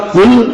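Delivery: قل said as "kul", with an ordinary k; qaf turned into kaf. Shown as a demonstration. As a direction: mispronounce قل as kul. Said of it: "کھاؤ".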